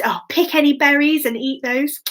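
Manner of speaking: ordinary delivery, nothing marked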